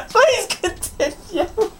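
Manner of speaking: high, slow words squeezed out through laughter; can barely speak for laughing; laughing hard. Real, not acted. Listening to friend's best man speech, helpless laughter.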